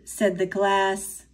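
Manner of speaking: plain reading voice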